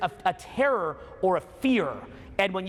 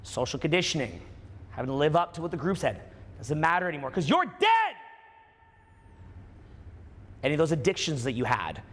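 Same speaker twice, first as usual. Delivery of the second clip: guided meditation; 4 semitones lower than usual. Acted. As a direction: lead them gently into it.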